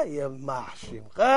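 low, slow and hushed; low muttered curse. Acted. King to the offending minister, low menacing curse. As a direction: drop it to a slow, soft curse.

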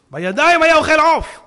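loud, high insistence; emphatic proclamation. Real, not acted. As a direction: proclaim it as decisive proof. Driving the point home. (הכרזה רמה ונחרצת)

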